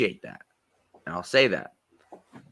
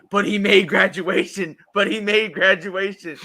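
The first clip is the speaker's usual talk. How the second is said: mocking voice; 10 semitones higher, 8 dB louder than usual. Mocking a post's caption.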